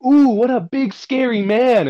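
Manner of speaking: high, loud sing-song mockery; mocking taunt. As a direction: taunt him like a scared child. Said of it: "I egged him on".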